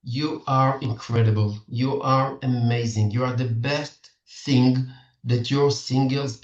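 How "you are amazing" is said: ordinary talk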